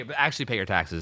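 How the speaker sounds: ordinary delivery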